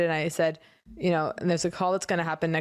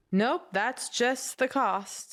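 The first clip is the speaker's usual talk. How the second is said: higher, very slow mock verdict; imitated dismissal; 4 semitones higher, much slower than usual. Imagining accountants answering her, imitated dismissal. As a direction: draw it out as a breezy brush-off.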